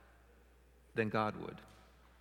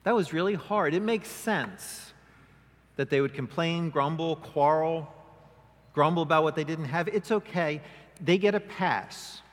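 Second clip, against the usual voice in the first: mock sympathetic God; 3 semitones higher, 5 dB louder than usual. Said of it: "he doesn't say".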